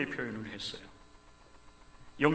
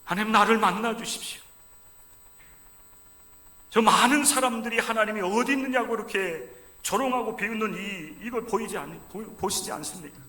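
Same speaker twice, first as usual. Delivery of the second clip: pleading prayer cry; 5 semitones higher, 5 dB louder than usual. Acted. Voicing David's desperate prayer.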